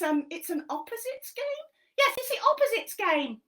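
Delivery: high, loud cheeky monkey voice; squeaky puppet monkey helping Helen. Acted. Monkey puppet explaining a game to Helen, high and bouncy.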